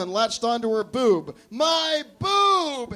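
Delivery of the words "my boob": high, loud shriek of pain; scream. Acted. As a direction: cry out as the puppy latches on. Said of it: "Hectorina screamed".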